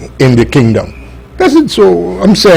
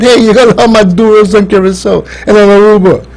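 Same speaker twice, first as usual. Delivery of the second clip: Maduro voice; 8 semitones higher, faster than usual. Retold or imitated. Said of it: "like Maduro said".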